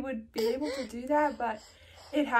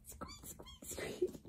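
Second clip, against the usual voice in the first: whispered squeak; 15 dB quieter, much slower than usual. Acted. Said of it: "Can you squeak like a bunny?".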